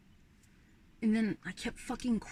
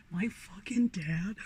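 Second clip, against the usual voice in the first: whispered laugh; slower than usual. Real, not acted. Laughing while telling story.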